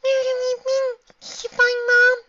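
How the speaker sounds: put-on very high, loud child voice; hopeful little filly. A narrator's reading. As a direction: ask with pleading hope. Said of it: "she asked hopefully".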